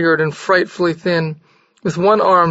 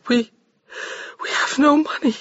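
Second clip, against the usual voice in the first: high, soft tearful confession; sinking whisper; 8 semitones higher, 4 dB quieter than usual. A narrator's reading. Maria admitting they have no money, near tears. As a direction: barely say it, ashamed. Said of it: "voice sank beneath a whisper".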